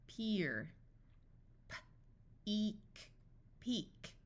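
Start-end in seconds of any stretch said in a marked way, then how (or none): 1.7-3.1 puffed P, pause, then "eek"; sounded out. Shown as a demonstration. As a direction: break "P-eek" into its sounds slowly. Phonics teacher sounds out, then blends.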